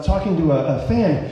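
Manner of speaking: ordinary talk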